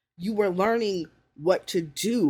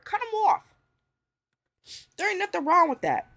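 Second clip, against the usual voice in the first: emphatic high push; 12 semitones higher, 4 dB louder than usual. Real, not acted. Emphatic advice.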